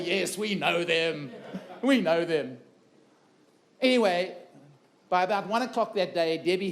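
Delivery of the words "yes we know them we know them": lightly put-on South African accent, slow and dry with a laugh. Speaker prompting English audience in a light South African accent. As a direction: mimic the South African crowd reply slowly. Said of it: "Just say".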